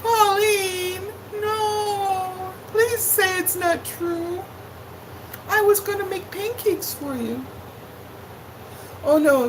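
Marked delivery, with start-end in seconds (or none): 0.0-7.5 high, whimpering, hammed-up begging; exaggerated fake sobbing plea, much higher; mock crying plea. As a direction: wail at her like a jilted friend. Begging viewer not to leave.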